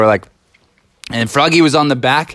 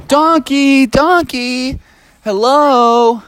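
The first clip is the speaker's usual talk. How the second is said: higher, louder calling; 10 semitones higher, 3 dB louder, much slower than usual. Acted. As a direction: call to find the donkey. Friends calling the donkey.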